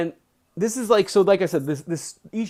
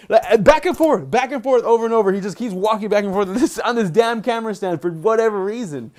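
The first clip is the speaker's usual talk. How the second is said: loud, high, worked-up complaint; real fed-up outburst; exasperated rant; 6 semitones higher, 5 dB louder than usual. Real, not acted. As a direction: vent loudly about the pacing dog. Ranting about the pacing dog.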